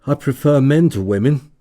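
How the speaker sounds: sudden, high and loud; blurted out. A narrator's reading. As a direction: blurt it out all at once. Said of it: "At last he blurted out".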